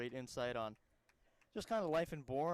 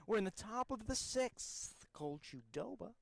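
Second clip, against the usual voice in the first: Reeves Jackson; 3 semitones higher than usual. Acted. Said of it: "get my Reeves Jackson call".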